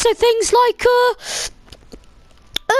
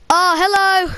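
ordinary delivery

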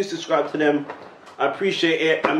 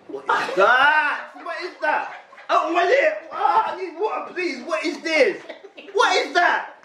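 pained shouting; 10 semitones higher, slower than usual. Real, not acted. Chilli heat reaction, shouting.

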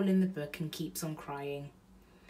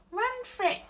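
put-on much higher toddler echo; baby voice; 3 dB louder than usual. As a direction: chirp it like a little child. Baby repeats phrase.

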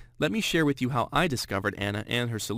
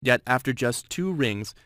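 usual speaking voice

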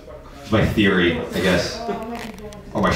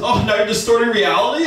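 mock accusation; 10 semitones higher than usual. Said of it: "She goes".

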